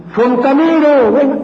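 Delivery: grito agudo y fuerte llamando al fontanero; llama al fontanero a voz en cuello; llamada a gritos. Retold or imitated. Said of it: "Y uno grita". (alguien llama a gritos al fontanero que venga, gritando)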